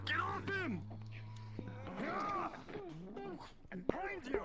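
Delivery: urgent high shouted warning; calling out. Acted. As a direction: yell a warning across the battlefield. Calling out during the fight.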